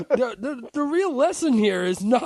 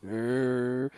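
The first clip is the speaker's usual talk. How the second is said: low droning "er"; 9 semitones lower than usual. Shown as a demonstration. Co-host showing the low of two speeds, low drone. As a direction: drone "er" low, like the slow setting.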